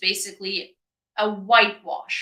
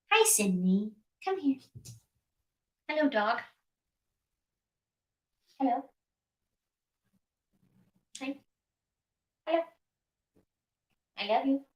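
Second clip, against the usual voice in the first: high, soft pet-talk voice; talking to a dog; 6 semitones higher, 4 dB quieter than usual. Real, not acted. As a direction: coo at the dog softly.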